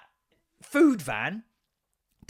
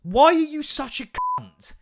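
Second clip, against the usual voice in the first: higher, louder furious outburst; real angry outburst; 8 semitones higher, 6 dB louder than usual. Real, not acted. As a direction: lash out in real anger at the customer. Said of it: "I'm getting angry now".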